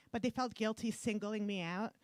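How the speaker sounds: normal talking voice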